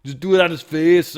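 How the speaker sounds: mock Schwarzenegger bellow. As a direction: pump it up like a giant meathead. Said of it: "He's like".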